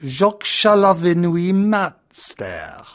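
slow, grand mock French accent; theatrical French accent; horrible French accent. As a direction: recite like a pompous French poet. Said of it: "my horrible French accent".

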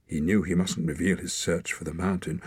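plain reading voice

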